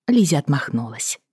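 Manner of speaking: ordinary delivery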